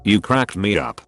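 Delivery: ordinary delivery, nothing marked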